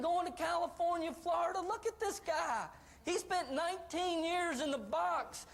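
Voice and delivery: high, rapid-fire wonder at someone's success; amazed excitement. Acted. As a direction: marvel at it in a high, thrilled voice. Acts out amazement at videotape.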